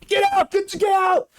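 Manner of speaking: yelling at a falcon to get out; angry shout. Acted. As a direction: chase it off with a broom, yelling. Apprentice chasing falcon with broom.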